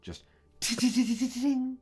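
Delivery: very high sung metallic ting; ringing ting. Acted. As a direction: imitate metal ringing on stone. Imitated metal clatter.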